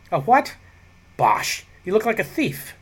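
high, huffy scoff; scoffing matron. A narrator's reading. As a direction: dismiss him like an outraged matron.

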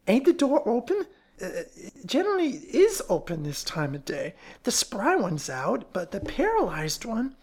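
high, folksy character voice; fat woman, puzzled. Acted. Fat woman wondering about the closed apartment, puzzled. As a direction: swoop up and down as a puzzled busybody.

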